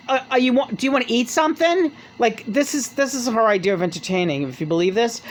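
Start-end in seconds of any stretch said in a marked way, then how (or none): 0.1-1.9 Madonna offering food. Retold or imitated. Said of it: "she's like".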